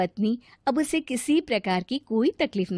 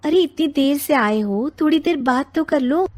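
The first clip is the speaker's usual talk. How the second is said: higher, louder wife's voice; wife pleading; 6 semitones higher, 6 dB louder than usual. Acted. Wife asking late husband to chat, higher voice. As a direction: ask him to talk a bit.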